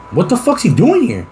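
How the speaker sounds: high-pitched, incredulous demand; put-on outraged questioning; acted indignation. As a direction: play it affronted, pitch jumping up. Acts out confronting a housemate.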